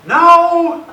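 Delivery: very loud, high mock yell; upset daughter. Daughter in her room refusing dad's talk, loud angry yell. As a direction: imitate a kid yelling to be left alone. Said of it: "I can tell she's upset".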